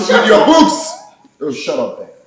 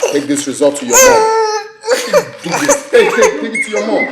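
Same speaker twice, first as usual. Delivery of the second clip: mocking laugh; 4 semitones higher than usual. Father mocking daughter's bad result.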